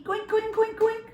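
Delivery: very high goofy goink noises; high squeaky monster goinks; silly goinking. Pretend. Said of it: "goinking makes me feel silly".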